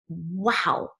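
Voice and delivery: ordinary talk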